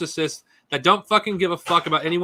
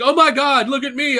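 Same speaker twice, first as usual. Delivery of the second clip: mock whine, pitched higher; whiny mockery; 6 semitones higher than usual. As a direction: ham up a self-pitying moaner. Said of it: "It's like".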